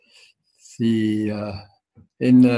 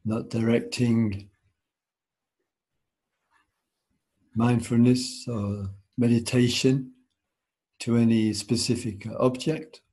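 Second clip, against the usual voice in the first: guided meditation. Acted. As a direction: ease them into stillness, unhurried.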